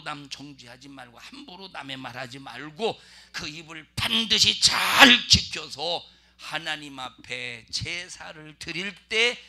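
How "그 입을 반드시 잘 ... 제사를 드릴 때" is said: fervent preaching. Real, not acted. Preacher exhorting congregation.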